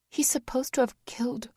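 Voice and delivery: breathless whisper of disbelief; horrified whisper. A narrator's reading. Vanessa staring at the photos, whispering in horror. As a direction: barely voice it.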